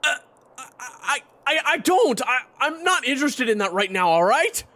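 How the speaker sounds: stammer, then burst out defensively; flustered outburst. Acted. Teased fighter protests he isn't interested, flustered outburst.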